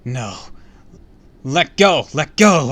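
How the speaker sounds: loud, rising cries of refusal; desperate scream. A narrator's reading. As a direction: start on a cry, build to screaming. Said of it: "I screamed".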